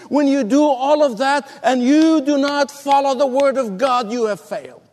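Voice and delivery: high, ringing sermon climax; preaching at full force. Acted. Preacher exhorts the congregation in a high, ringing voice. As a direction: build it like a preacher in full flight.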